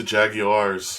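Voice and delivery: "Jaguars" drawn out as JAG-ee-ars; British-style pronunciation. Retold or imitated. Co-host correcting the team name, "Jaguars" said JAG-ee-ars. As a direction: stretch "Jaguars" slowly into three syllables, JAG-ee-ars. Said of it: "like, the accent".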